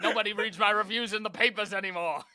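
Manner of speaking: put-on loud, high old critic; bitter old critic. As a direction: complain like a forgotten critic.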